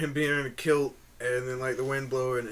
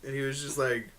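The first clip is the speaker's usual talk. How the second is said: ordinary delivery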